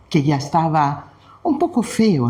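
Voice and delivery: usual speaking voice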